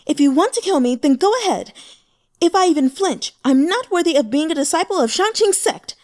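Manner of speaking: high, loud, unflinching defiance; loud, resolute heroine facing death. A narrator's reading. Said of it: "Bai Li Qingmiao said resolutely".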